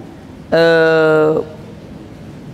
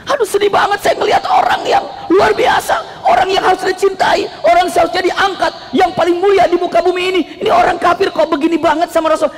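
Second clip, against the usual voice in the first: anguished lament; 9 semitones higher than usual. Acted. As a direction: wail out sorrow for the Prophet. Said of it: "Sedih, kasihan banget saya ngelihatnya".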